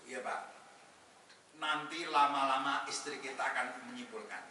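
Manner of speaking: usual speaking voice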